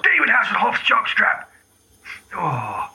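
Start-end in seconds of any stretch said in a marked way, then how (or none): 0.0-1.4 blurted outburst. Acted. Sneeze swear outburst.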